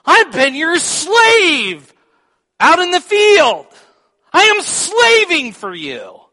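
loud, high, slow resentful cry; angry, not whispering. Acted. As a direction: hurl it at the father, slowly. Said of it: "he's not whispering this".

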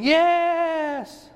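high excited "Yes!" in a put-on voice; Abraham's eager yes. Preacher acting out Abraham answering the doubters, high eager yes. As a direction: answer back with delighted eagerness. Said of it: "And Abraham's going".

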